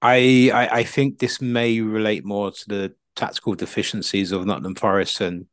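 ordinary talk

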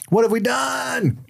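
high, slow panicked cry. Retold or imitated. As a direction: cry it out in horror.

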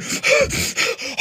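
high, exaggerated gasping; mock breathless gasps. Upset podcast member who couldn't get his breath, mock gasping. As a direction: gasp as if imitating someone out of breath. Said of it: "couldn't get his breath".

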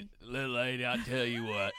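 lightly put-on southern comic impression. Podcaster imitating a guest to his co-host, folksy impression. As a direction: imitate a folksy comic addressing a little lady. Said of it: "did he call me little lady".

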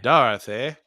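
Dorothy in a Mid-Atlantic accent, loud, with a rising end; old Hollywood Mid-Atlantic accent on Dorothy. Shown as a demonstration. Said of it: "My Mid-Atlantic accent".

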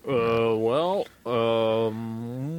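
drawn-out hesitant filler sounds; mock stalling. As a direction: imitate yourself stuck for an answer, dragging each filler. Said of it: "so much dead air while I sit there".

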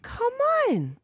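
high pleading come on; incredulous cry. Real, not acted. Friend can't believe her husband skipped the movie, incredulous. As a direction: squeal it in disbelief. Disbelief at husband not seeing it.

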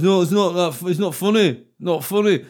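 comic imitating heckler shouting it's not funny; mock drunk jeering. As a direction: jeer at him like a drunk punter. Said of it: "he was like".

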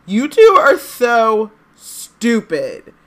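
high, loud and very slow wail; tearful outburst. A narrator's reading. Hermione crying at the boys, loud slow wail. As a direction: wail it out through tears. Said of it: "Hermione burst into tears".

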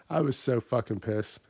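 ordinary delivery

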